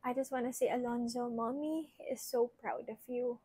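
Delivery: soft, quiet heartfelt words; emotional thanks. Real, not acted. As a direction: speak softly, holding back tears. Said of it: "I don't wanna get too emotional".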